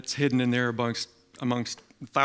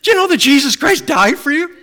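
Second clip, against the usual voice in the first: loud, much higher, fast and pressing; eager fast question; 13 semitones higher, 12 dB louder than usual. Retold or imitated. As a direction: rush the question with zeal. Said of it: "each time he's saying that".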